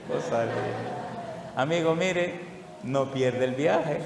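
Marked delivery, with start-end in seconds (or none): 0.1-1.5 slow whispered aside, much softer; amused whisper. Real, not acted. Preacher chuckling over the old woman's trick, slow soft whisper.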